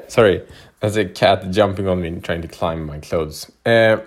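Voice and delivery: ordinary delivery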